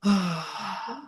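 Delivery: long, soft sigh of relief; contented sigh. Shown as a demonstration. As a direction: let out a feel-good "ah". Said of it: "ah, we feel good".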